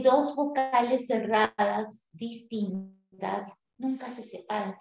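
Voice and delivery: dos vocales cerradas distintas said slowly; slow rule read for students; slow dictation. Shown as a demonstration. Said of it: "bien claro".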